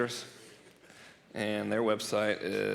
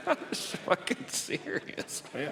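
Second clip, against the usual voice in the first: high, breathless words squeezed out through laughing; real cracking up, voice nearly gone; helpless laughter; 3 dB quieter, much slower than usual. Real, not acted. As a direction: wheeze it out mid-laugh. Helpless laughter at own joke.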